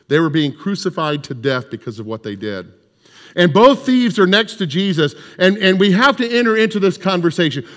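ordinary talk

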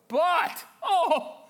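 high, loud sudden yell. Shown as a demonstration. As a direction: yell it to rouse the room. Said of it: "Somebody yell it".

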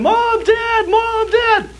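put-on very high panicked child scream; lost child screaming for his parents, very high desperate scream. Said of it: "I start screaming".

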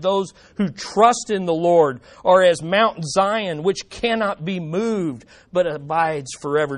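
preacher reading a psalm to the church, fervent; scripture reading. Acted. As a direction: read the psalm aloud as a heartfelt cry. Reads psalm aloud.

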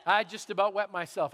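ordinary talk

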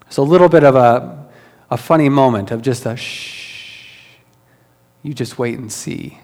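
ordinary delivery

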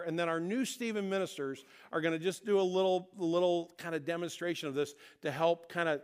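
normal talking voice

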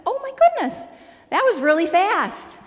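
high delighted gasp of surprise; pleasantly startled, voice jumping up; surprised. Retold or imitated. Surprised to see him at the door quickly, high exclamation.

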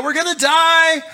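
high, loud panicked cry; freaking out. Retold or imitated. Disciples in the storm screaming in high panic. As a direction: shriek it like drowning men. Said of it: "his disciples are freaking out".

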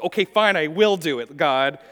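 begrudging "okay, fine" prayed at God; sulky teen. Retold or imitated. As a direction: say it like a sulky adolescent. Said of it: "adolescent attitude where I was like".

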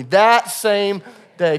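"That same day" drawn out slowly, each word weighted; slow emphasis. Shown as a demonstration. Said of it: "say that out loud with me".

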